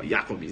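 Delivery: low "Jacobs", YA-cobs; correct pronunciation. Shown as a demonstration. Teacher wraps up the drill, German pronunciation. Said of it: "vai treinando".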